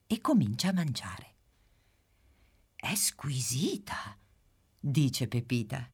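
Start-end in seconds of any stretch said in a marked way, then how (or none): 2.8-4.2 slow soft whisper of pleasure; whispered delight. Acted. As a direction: savour it quietly. Pepita tastes the dish.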